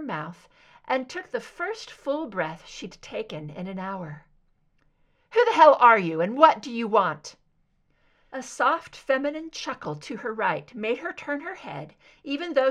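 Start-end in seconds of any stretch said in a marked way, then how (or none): 5.3-7.4 loud, furious challenge; shouted demand from a gagged prisoner; angry demand. Acted. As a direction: bark it at the unseen captor. Bound captive demands angrily.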